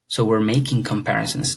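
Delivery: normal talking voice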